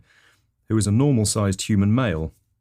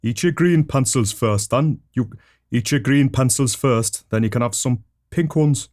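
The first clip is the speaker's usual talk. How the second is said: higher-pitched Geordie mum; Geordie accent; 4 semitones higher, 4 dB louder than usual. Acted. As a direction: put on a Geordie mother's voice. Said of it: "Missus Pencil Sharpener had a Geordie accent".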